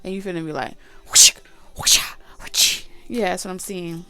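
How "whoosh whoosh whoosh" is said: breathy rushing whoosh noises; quick imitated whoosh; whooshing sound effect. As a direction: mimic things zipping by. Zombies rushing past sound.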